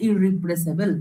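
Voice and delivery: ইররিপ্লেসেবল said low and soft, rising at the end; quiet low demo word. Shown as a demonstration. Teacher to students, soft low demo word. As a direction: finish the word ইররিপ্লেসেবল softly. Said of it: "ভালো করে দেখ আমি কি বলছি".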